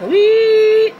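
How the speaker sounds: high whistly "wheet"; loud, high, drawn-out siren squeal; imitated siren sound. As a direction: mimic the siren with one long high note. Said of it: "Making a siren-y sound".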